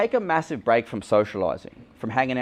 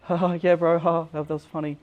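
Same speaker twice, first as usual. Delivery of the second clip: forced laugh; 7 semitones higher than usual. Retold or imitated. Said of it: "I'm like".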